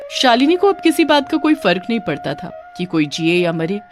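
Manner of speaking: ordinary delivery, nothing marked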